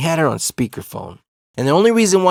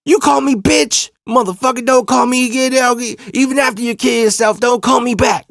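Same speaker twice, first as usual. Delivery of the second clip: high, loud mock-furious tirade; imitated outrage; 11 semitones higher, 4 dB louder than usual. Girlfriend blasts cousin before hanging up, imitated angry yelling. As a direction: tell him off, imitating an enraged girlfriend.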